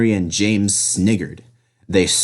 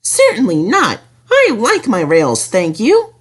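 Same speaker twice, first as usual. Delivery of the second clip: much higher, chirpy small engine; little engine voice; 11 semitones higher than usual. Acted. Percy grumbles at the helicopter in his high voice.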